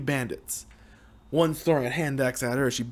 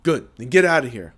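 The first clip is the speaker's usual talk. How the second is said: louder firm command; firm order; 8 dB louder than usual. Acted. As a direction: order her out. Ordering her to leave.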